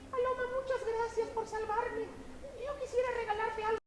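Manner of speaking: very high, small squeaky ant voice; little ant thanking the dove; tiny ant voice. Acted. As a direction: speak as a tiny grateful ant.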